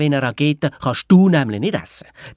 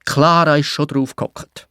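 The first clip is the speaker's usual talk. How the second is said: usual speaking voice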